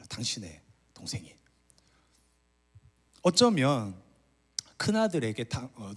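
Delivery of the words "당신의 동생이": unvoiced whisper of the servant's news; 속삭임. Shown as a demonstration. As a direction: whisper 당신의 동생이 under the breath, no voice. Repeats servant's words, whispered. (당신의 동생이를 숨소리로 속삭이듯)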